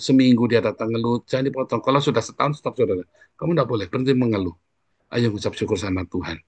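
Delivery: normal talking voice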